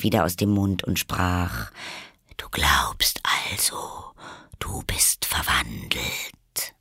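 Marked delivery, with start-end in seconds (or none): 2.4-6.7 low, soft whisper; caterpillar. A narrator's reading. The caterpillar coolly contradicts Alice in a whisper. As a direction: dismiss her in a low whisper.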